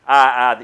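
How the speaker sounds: "I, I" hit loudly, twice; emphatic I. Shown as a demonstration. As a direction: stress each "I" firmly. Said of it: "the emphasis upon".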